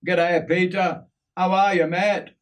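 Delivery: high-pitched attempt at an Aussie greeting; lively, improving Aussie accent. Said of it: "My use of the Aussie accent and lingo".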